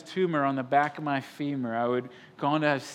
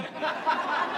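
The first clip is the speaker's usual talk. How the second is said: laugh; 12 semitones higher than usual. Real, not acted. Laugh at his own story.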